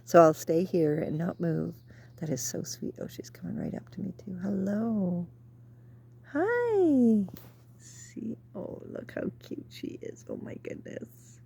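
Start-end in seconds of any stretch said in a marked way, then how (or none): 2.2-4.4 hushed whisper so the bird stays; whispering in awe as a bird comes close. Real, not acted. 4.4-7.2 high, soft coo to a bird; cooing hello. Real, not acted. Greeting the bird sweetly. 7.8-11.4 softly whispered delight; whispering to yourself over a cute bird; awed whisper. Real, not acted.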